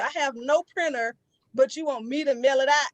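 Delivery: ordinary delivery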